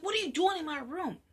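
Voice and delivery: loud, fast alarmed question. Acted. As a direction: demand it fast and shocked. Girl finds man in room.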